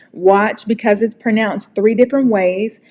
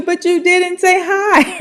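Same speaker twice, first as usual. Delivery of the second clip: laughing; 8 semitones higher than usual. Real, not acted. Joking about being ignored.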